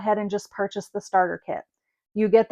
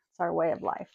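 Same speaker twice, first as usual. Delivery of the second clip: softer, lower repeat of the motto; a murmured repeat, lower and softer; soft echo; 4 semitones lower, 8 dB quieter, faster than usual. Shown as a demonstration. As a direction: echo it to yourself, quieter.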